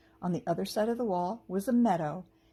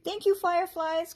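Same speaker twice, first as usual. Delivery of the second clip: high, louder call of thanks; 10 semitones higher, 5 dB louder than usual. A narrator's reading. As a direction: call out to the fireflies. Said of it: "called Fern".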